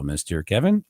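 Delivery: normal talking voice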